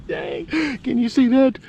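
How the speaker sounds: very high-pitched, thrilled voice; excited squeal. Real, not acted. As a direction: squeal it through giddy laughter at a find. Thrilled at what he uncovers.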